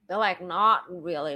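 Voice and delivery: ordinary talk, nothing marked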